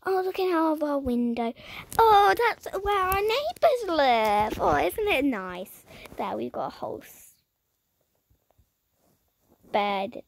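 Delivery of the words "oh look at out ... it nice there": ordinary talk, nothing marked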